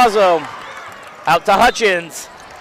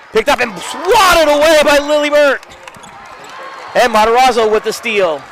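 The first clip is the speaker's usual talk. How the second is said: higher and louder on the swat; excited call; 7 semitones higher, 5 dB louder than usual. Real, not acted. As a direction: build up the steal. Steal called excitedly.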